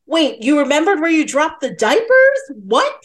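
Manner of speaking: high-pitched, astonished questioning; incredulous. Retold or imitated. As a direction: question it like a stunned viewer. Viewer's incredulous reaction acted out.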